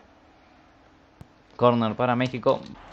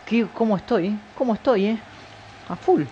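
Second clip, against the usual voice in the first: playful bragging; 10 semitones higher than usual. Real, not acted. Bragging after scoring.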